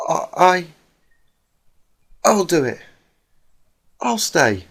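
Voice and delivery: timid stammering volunteer. Acted. Tearful Fluttershy volunteers.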